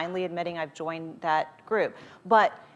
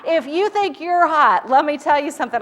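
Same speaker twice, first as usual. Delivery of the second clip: much higher and louder, slowed; 8 semitones higher, 9 dB louder than usual. Real, not acted. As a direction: call it out with playful emphasis. Joking about the hot room.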